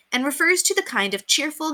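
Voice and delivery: plain reading voice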